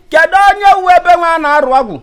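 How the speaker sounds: ordinary delivery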